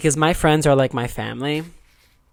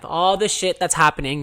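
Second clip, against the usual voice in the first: ordinary delivery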